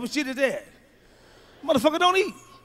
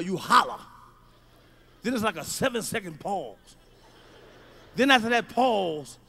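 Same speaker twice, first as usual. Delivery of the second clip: ordinary talk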